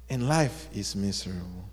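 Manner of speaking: low, mildly glum resignation; complaining. Acted. Rock with broken edges sums up its lot, glumly complaining. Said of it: "the rock was complaining".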